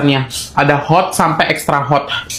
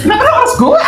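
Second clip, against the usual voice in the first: high exasperated yell; exasperated shout; faster than usual. Real, not acted. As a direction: shout it in mock despair. Exasperated at viewers' requests.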